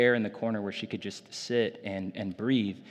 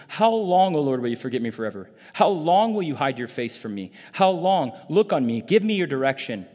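pleading psalm reading; 4 semitones higher, 6 dB louder than usual. Acted. Pastor reads a lament psalm.

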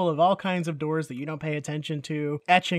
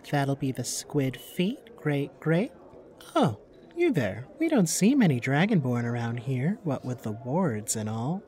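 barmaid voice. Acted.

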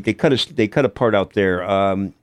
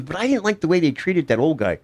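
imitating a girl; 8 semitones higher than usual. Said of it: "She goes".

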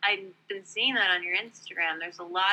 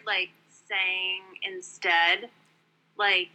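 normal talking voice

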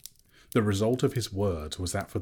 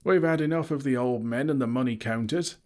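louder, higher angry crowd; crowd shouting; 6 semitones higher, 4 dB louder than usual. A narrator's reading. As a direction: jeer at the old men in charge. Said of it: "shouted in reply".

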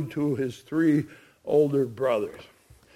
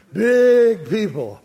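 BIG stretched long, high and loud; emphatic stress; 5 semitones higher, 6 dB louder, slower than usual. Real, not acted. Preacher sums up the giant family, emphatic stress on "Big". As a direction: lean hard on "Big" and draw it out.

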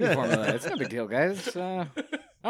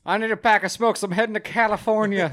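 louder, higher pretend customer; mock customer voice; 7 semitones higher, 7 dB louder than usual. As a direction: play the bearded lady in the drive-thru. Voicing the bearded lady.

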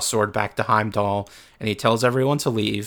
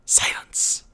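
low menacing whisper; whispered silence. Retold or imitated. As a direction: breathe it out coldly. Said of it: "And Odin just says".

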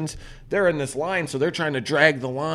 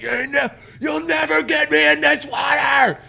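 mock shout; 13 semitones higher than usual. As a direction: yell it up high like a panicking kid. Refusing, shouting at mom.

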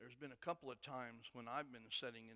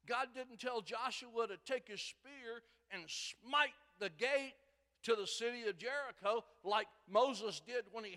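louder, higher preaching, building with passion; fiery preaching; 10 semitones higher, 13 dB louder than usual. Acted. Preacher stirring up his church with full force. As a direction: drive the story home to the congregation.

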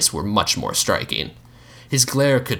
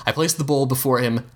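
ordinary delivery, nothing marked

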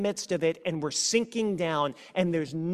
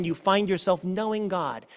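ordinary delivery

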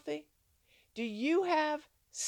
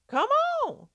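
high, loud call; 13 semitones higher, 5 dB louder than usual. Acted. Jesus calls Peter onto the water, a high loud call.

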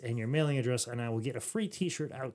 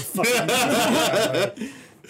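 loud, high, drawn-out joking outburst; mock fury; 6 semitones higher, 7 dB louder, much slower than usual. Host tells off teasing co-host, mock-furious. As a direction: explode in mock anger, stretching the words. Host's comic retort to teasing.

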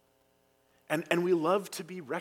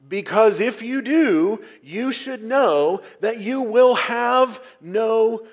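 loud, higher, slowing on the last words; emphatic, urgent warning; 7 semitones higher, 8 dB louder, slower than usual. Acted. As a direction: drive the warning home forcefully. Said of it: "it's a very emphatic and urgent statement".

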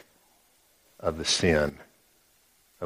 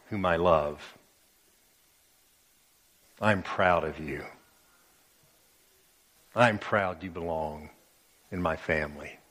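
tender voice of God; 5 semitones lower than usual. Pretend. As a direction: speak it like a loving father to his child. God's voice spoken to listeners.

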